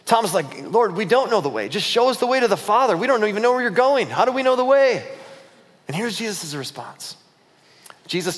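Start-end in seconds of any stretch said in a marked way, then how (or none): 0.7-5.0 acted protest, rising high; exasperated plea. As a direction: pitch climbing higher line by line. Thomas pleads with Jesus.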